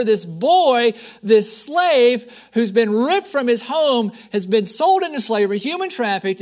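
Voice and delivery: emphatic preacher in full flow; preaching. Real, not acted. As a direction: drive the story home to the congregation. Preaches with rising force.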